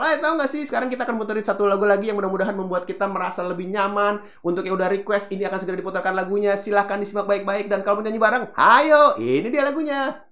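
high, fast, bubbly DJ patter; chatty youth radio DJ. Retold or imitated. Teacher imitating a youth radio DJ rushing into a song, lively and fast. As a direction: hype up the next song for young listeners. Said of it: "Kalau sekarang kan ngobrol".

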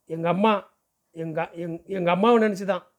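halting, faltering words that drop away and restart; close to tears. A narrator's reading. Young cleaner thinking of his mother, tearfully halting. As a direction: stammer as if about to cry. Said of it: "விசித்து அழத் துவங்கினான்".

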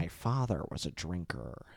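low, whispery villain monologue; hushed, low movie villain; Joker impression. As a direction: confide it in a creepy near-whisper. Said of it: "Heath Ledger did a really fucking good job".

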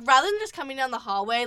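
ordinary talk

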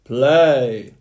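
one loud roared command; giant roaring. A narrator's reading. Giant orders the harp, roaring. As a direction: bellow the single word. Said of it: "roared the giant".

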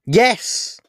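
loud, high yell of yes. A narrator's reading. Boy accepting the offer, yelling. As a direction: shout it into the air. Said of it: "I yelled loudly into the air".

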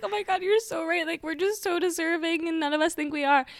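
high, teary mock sob; half-crying, gushing at a podcast. Friend retelling crying on the way to work, teary high voice. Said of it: "'Cause I'm like".

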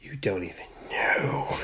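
low mysterious whisper, teasing; spooky whisper. Pretend. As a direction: hush it like a secret. Spooky teasing aside to listeners.

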